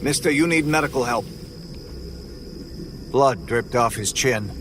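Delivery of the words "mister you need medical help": usual speaking voice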